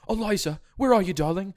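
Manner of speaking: high, dramatic British "Eliza, where are you, darling?"; mock-dramatic British husband calling for Eliza; British accent. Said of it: "him in a British accent going".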